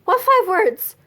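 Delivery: high-pitched retold panicky question; freaking out. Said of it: "I'm freaking out. And I was just like".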